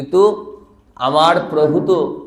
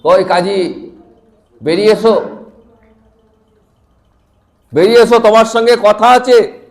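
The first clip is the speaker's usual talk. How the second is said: raised, commanding summons, building louder; calling out; 5 semitones higher, 6 dB louder than usual. Acted. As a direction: call someone out of hiding, growing higher and louder. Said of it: "তখন ডাকছেন প্রভু".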